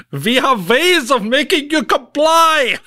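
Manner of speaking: put-on slow, loud, high menacing German accent; German villain accent. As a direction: ham up a sinister German accent, slowly. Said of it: "she is a German".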